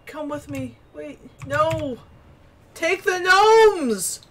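high, drawn-out desperate cry; urgent high calling. Real, not acted. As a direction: call them back urgently. Calling to gnomes.

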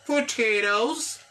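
"potatoes" said slowly with the final s drawn out; slow model word. Shown as a demonstration. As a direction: stretch "potatoes" and end on a clear z. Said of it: "petite prononciation". ("potatoes" lentement, s final bien marqué)

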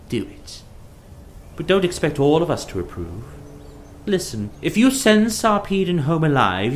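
high-pitched, reproving Hera voice; indignant goddess wife. A narrator's reading. Narrator voices Hera rebuking Zeus.

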